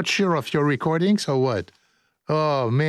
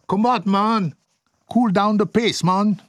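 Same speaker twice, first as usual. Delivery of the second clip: higher-pitched mock Jamaican patter; 6 semitones higher than usual. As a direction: put on a playful Jamaican lilt. Joking with Jamaican slang.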